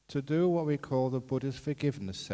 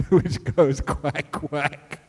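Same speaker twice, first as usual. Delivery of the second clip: loud, amused, breaking into laughs; real giggles breaking up the words; laughing while talking; 7 dB louder, slower than usual. Real, not acted. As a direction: struggle through your own joke with laughter. Laughing at own joke.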